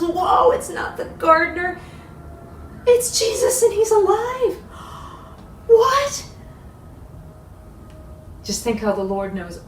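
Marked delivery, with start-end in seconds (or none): 0.0-6.3 high, loud cries of amazement; astonished joy. Acted. Mary realizing it is Jesus, crying out in high astonishment. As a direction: climb higher with each shocked discovery.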